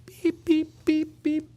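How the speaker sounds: high mock beep-beep of a scanner; imitating the checkout scanner, high beeps. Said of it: "the checker's like".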